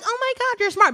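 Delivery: high, gushing "Oh my God, you're smart"; mock gushing praise. Director imitates a guy she wishes had been impressed, high gushing. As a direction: play an impressed fan who is bowled over. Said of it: "Not like".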